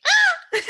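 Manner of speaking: high, loud playful scream; mock child shriek. Mother imitating her daughter's startled laughing scream. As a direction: squeal like a startled kid.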